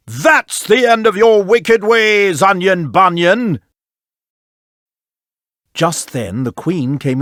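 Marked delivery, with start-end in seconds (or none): 0.1-3.6 slow, slightly raised shout; king shouting. Acted. Angry king hurling the viper out the window. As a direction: throw out the words with the snake.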